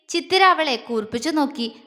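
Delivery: plain reading voice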